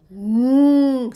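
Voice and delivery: N said as a long "ng" from the throat; deep throat sound. Shown as a demonstration. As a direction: hum N at the back of the throat, "nnng". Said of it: "that deep throat sound".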